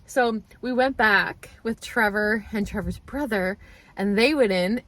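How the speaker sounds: ordinary delivery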